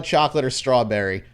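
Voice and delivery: normal talking voice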